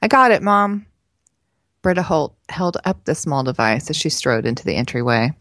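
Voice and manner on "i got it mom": higher-pitched reply to Mom; a touch of teenage daughter calling back; lightly voiced daughter. A narrator's reading. Daughter answers her mother from another room, lightly voiced.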